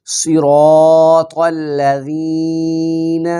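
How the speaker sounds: صراط with the ra held long and الذین with the ya stretched, very slow; slow tajweed recitation with long ra and long ya; lengthened vowels. Shown as a demonstration. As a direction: chant صراط الذین pulling out the madd letters. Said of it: "صراط کی را کو لمبا کرنا ہے".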